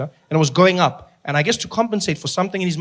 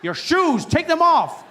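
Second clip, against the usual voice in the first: insistent security guard; 10 semitones higher, slower than usual. Retold or imitated. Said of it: "They're like".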